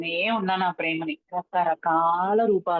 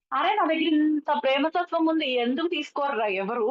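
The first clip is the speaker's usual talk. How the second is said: put-on exasperated childhood thought; 6 semitones higher than usual. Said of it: "అనుకునేదాన్ని".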